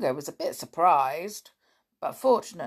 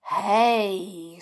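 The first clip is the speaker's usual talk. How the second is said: sudden; 3 semitones higher, 6 dB louder than usual. Acted. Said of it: "said Dick suddenly".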